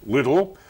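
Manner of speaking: "little" set apart and said deliberately; stress on little. Real, not acted. Host teasing a pundit about his little mate, stress on "little". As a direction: lean on the word "little". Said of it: "I emphasise the word little".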